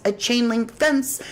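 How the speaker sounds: ordinary talk, nothing marked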